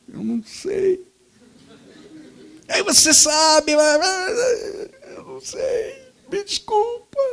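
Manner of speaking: put-on a frightened kid stammering; scared boy. Trembling boy answering the angry neighbor, near whispering.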